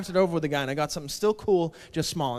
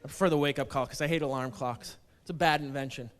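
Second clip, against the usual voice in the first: ordinary delivery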